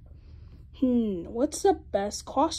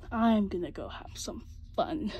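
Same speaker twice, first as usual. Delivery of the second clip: ordinary delivery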